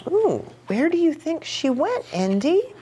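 usual speaking voice

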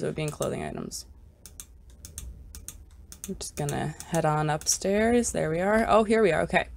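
ordinary delivery

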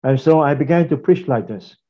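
usual speaking voice

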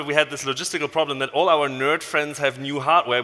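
ordinary talk, nothing marked

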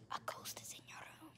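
soft unvoiced spooky whisper; whispered ghost prank; ghostly whisper. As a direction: whisper it to scare her. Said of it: "I'm gonna be like".

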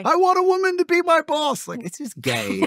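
very high mocking falsetto; mock effeminate man. Mocking men who want female boss.

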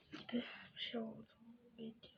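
faint hushed whisper. Real, not acted. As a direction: let it fade into a whisper. Whispering after ASMR mention.

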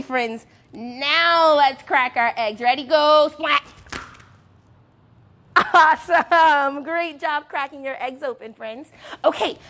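ordinary talk, nothing marked

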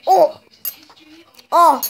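startled loud oh; surprised. Real, not acted. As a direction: react in shock.

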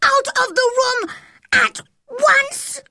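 very high, loud furious order; angry shout. A narrator's reading. Boy orders her out of his room, shouting angrily. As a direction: shout it out word by word. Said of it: "he shouted".

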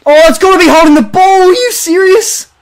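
disbelief. Real, not acted. Complaining to viewers about the umpire, incredulous. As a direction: protest the call in disbelief. Outraged at a decision.

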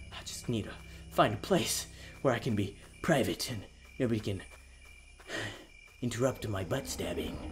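very low, very soft pretend madman; creepy hushed muttering. Player pretending to go insane, creepy hushed muttering. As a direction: murmur it secretively, barely audible.

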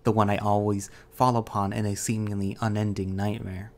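ordinary delivery, nothing marked

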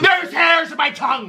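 high-pitched frantic complaint; exasperated outburst. Pretend. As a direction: shriek it over the other person's nagging. Shouts over partner's repeated needling.

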